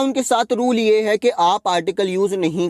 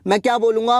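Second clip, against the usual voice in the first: ordinary talk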